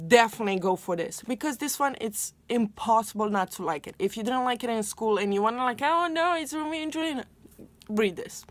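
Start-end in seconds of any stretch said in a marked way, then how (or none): none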